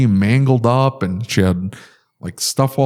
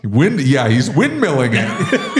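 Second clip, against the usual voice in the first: ordinary delivery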